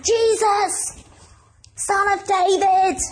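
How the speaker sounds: high, calling mock shout to Jesus; retold shout. Blind Bartimaeus calling to Jesus from the roadside, mild mock shout. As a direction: cry out after a passing crowd, mildly acted. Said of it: "He starts shouting out".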